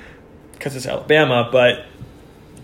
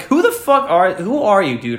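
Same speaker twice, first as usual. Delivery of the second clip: high-pitched indignant retort, retold; mock-furious challenge; mad; 5 semitones higher than usual. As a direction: replay your outrage without really shouting. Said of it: "I was so mad".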